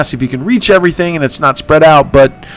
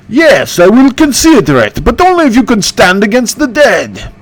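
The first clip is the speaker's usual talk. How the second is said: higher ghost king voice, haughty; Bertu voice; 7 semitones higher than usual. Acted. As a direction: play the cursed oathbreaker, raised and lofty. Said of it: "that's my impression".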